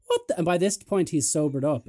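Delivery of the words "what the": bewildered friend. Retold or imitated.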